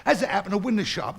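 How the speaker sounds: normal talking voice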